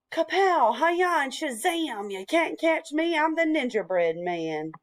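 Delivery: high singsong catchphrase, dropping at the end; playful taunt. A narrator's reading. Ninja bread man rolling over the cow, singsong taunt. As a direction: chant it high, then settle on the name. Hero repeats his taunting refrain.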